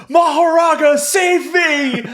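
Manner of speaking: loud, high hammed-up cry for help; meme shout. Quoting the meme to his co-host, in a loud high shout. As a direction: bellow it like a desperate summoner. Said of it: "Fraud Kuna posting".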